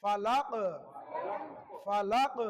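emphatic qalqala on the last letter; qalqala bounce. Shown as a demonstration. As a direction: let the qaf of ফালাক rebound audibly. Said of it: "কলকলা করে পড়তে হয়".

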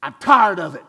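loud, fed-up cry of weariness; shouting I'm tired of it out loud. Shown as a demonstration. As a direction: belt out how fed up you are. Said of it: "or you say".

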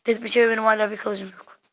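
normal talking voice

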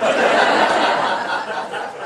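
a loud breathy burst of laughter. Real, not acted. Eulogist laughing at Bob's real cars, loud laugh.

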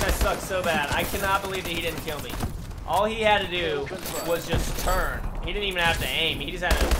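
ordinary delivery

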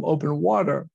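ordinary delivery

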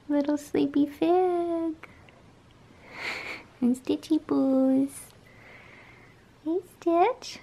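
pet talk. Real, not acted. To her sleepy cats Fig and Stitch, high slow cooing. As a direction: coo tenderly at a sleepy kitty.

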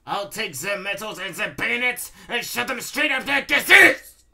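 high, loud villain's rant; bellowing. A narrator's reading. Baron raging to himself at his defeat, bellowing. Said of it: "He bellows to himself".

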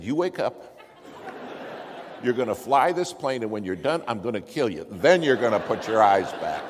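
loud, panicked threat to a useless pilot; furious yell. Retold or imitated. Passenger to passed-out pilot in midair, furious yell. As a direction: yell orders with rising force.

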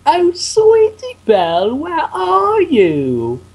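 high, drawn-out lilting character voice; Rarity. Acted. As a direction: call out high, stretching the last words. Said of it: "try and top my Rarity voice".

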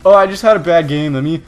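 whiny excuse. Retold or imitated. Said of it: "they're like".

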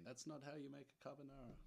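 badly faked Italian accent, as a protesting nonna. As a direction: put on a hammy Italian accent. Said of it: "a really bad Italian accent".

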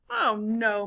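understated crowd moan. A narrator's reading. Crowd watches the seesaw fail, mild moaning. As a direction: let it sink in mild dismay. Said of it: "moaned the crowd".

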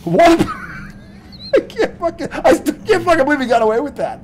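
high, loud laughing outrage; real laughing incredulity; laughing disbelief. Real, not acted.

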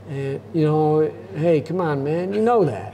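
höher, langsam und gedehnt, leicht zittrig; Biden impression. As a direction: mach Biden, alt und etwas verwirrt. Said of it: "I've been working on Biden".

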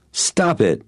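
insist, with irritation starting to show; irritated insistence. A narrator's reading. Buyer insisting the seller stop pushing, irritated. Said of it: "becoming irritated and letting it show".

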